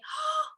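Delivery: sharp high gasp of shock; a sudden worried gasp; mock alarmed gasp. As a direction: gasp as if seeing blood. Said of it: "my mother might say".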